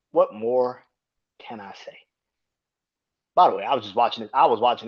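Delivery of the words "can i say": whisper. Real, not acted. Whispered aside.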